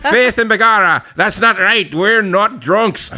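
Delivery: put-on loud, high stage-Irish accent. Teetotal Irishman objecting in comments, indignant mock Irish accent. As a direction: protest in a hammed-up Irish accent, slowing at the end. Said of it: "I gotta switch to Irish".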